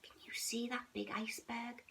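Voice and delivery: quick hushed question; soft whisper. A narrator's reading. Mother nuzzling the cub close, whispered. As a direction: lean in and whisper.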